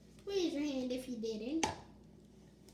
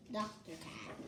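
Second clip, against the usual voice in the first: 1 quiet; 9 semitones lower, 9 dB quieter than usual. Acted. Child reads the character's name.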